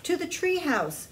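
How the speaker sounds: high, loud urgent call; urgent cry. A narrator's reading. Jack urging Annie toward the treehouse, shouting. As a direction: shout the way to safety. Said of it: "cried Jack".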